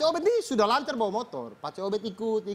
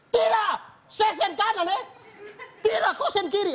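flustered denial; 10 semitones higher, 3 dB louder than usual. Retold or imitated. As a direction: deny it, then catch yourself. Minggus denies, then sees it.

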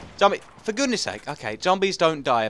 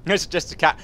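ordinary delivery

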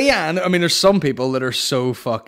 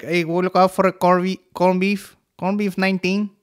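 higher-pitched, lightly put-on Mexican accent; mock Mexican accent; 5 semitones higher than usual. Retelling a man on the news, mock Mexican accent. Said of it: "he was obviously Mexican or something".

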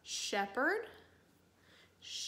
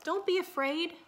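higher, lifted reassuring call; 7 semitones higher, 4 dB louder than usual. A narrator's reading. Shepherd to his lost lamb in the dark, calling out. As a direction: call out to someone far off. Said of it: "the shepherd called out".

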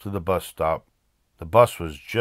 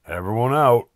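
loud, higher driver's order; calling out; 4 semitones higher, 6 dB louder than usual. A narrator's reading. Driver emptying the bus at last stop, calling out. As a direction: call it to the whole bus. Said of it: "called the driver".

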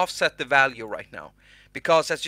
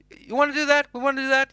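much higher, playful little voice; squeaky silly voice; 10 semitones higher, faster than usual. Acted. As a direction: pitch it up like a cartoon sidekick. Asks himself in squeaky voice.

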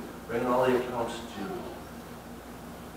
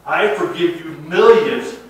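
indignant king; 6 semitones higher, 11 dB louder than usual. Retold or imitated. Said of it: "The king called the man back and said".

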